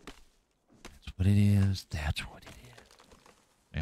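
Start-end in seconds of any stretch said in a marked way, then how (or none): 0.1-1.9 very slow, stretched-out voice; slow drawn-out voice. Shown as a demonstration. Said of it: "repeated in other voice". 1.9-2.8 breathy whisper. Shown as a demonstration. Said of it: "repeated in other voice".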